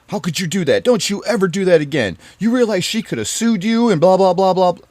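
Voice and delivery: imitating stepdad yelling. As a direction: bellow it like an angry stepdad berating you. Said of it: "he just starts screaming and yelling at me".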